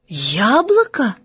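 put-on slow, questioning little-girl wonder; surprised. A narrator's reading. Girl discovers the real word, slowly surprised. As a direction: draw the word out in astonishment. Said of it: "Она удивилась и говорит".

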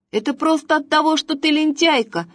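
put-on high, fast cheeky boy; chatty boy. A narrator's reading. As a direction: blurt it out like a brat. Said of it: "ответил ей Василёк-болтунок".

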